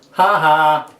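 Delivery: dry, mock laugh; sarcastic laugh. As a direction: spell out a fake, unamused ha ha. Go ahead, laugh.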